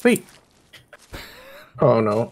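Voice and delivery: ordinary talk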